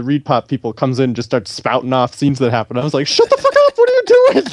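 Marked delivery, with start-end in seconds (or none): 3.1-4.5 very high-pitched comic protest; mock outraged outburst. Cutting off a colleague spouting spoilers, in high mock outrage. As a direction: squawk it in hammed-up outrage.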